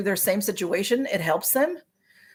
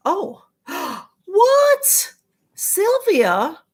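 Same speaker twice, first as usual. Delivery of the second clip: gasp, then a high startled what; real shocked gasp and question; 10 semitones higher, 5 dB louder, much slower than usual. Real, not acted. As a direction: caught off guard by bad news. Reads shocking news in chat.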